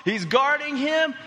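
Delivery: high, loud, excited game call; play-by-play. Retold or imitated. Dad retelling his son's last seconds of the final, excited play-by-play. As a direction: build to a yelled championship call.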